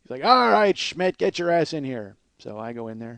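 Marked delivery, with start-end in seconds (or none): 0.2-2.2 loud, high bark, then slow; loud aggressive guy. Retold or imitated. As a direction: boom it like a pushy boss. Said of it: "He's just a loud, loud, aggressive guy".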